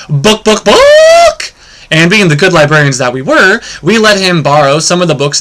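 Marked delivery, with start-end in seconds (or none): 0.1-0.6 short clucking chicken book book; chicken clucks. Acted. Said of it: "and the chicken said". 0.6-1.5 book squawked very high and loud; chicken squawk. Acted. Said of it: "and the chicken said".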